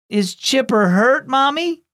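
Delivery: a touch of a wail, rising high on Mommy; worried little girl half-wailing to mom; mild wail. Acted. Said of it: "wailed Jada".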